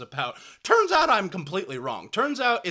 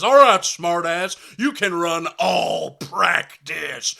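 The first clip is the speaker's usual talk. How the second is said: loud, slow punishing coach voice; stern coach; 10 dB louder, much slower than usual. Retold or imitated. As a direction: lay down the punishment slowly. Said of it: "he goes".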